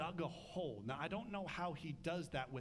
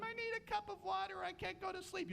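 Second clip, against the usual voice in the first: put-on much higher, whiny little kid at bedtime; whining child. Child begging parents at bedtime, high whiny voice. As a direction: whine like a kid stalling sleep. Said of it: "they're whining for the twentieth time".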